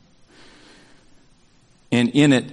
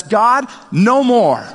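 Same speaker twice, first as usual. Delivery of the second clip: slow, firm, higher no more; resolute declaration; 9 semitones higher, 4 dB louder, much slower than usual. Retold or imitated. Someone stopping a family pattern before God, slow resolute vow. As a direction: plant your feet and refuse, slowly. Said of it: "stands up and says".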